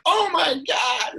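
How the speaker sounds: high, slow gasp of amazement; amazed. Retold or imitated. Grateful lady amazed by bags of money, high slow gasp. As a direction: stretch it out, overwhelmed. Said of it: "she's like".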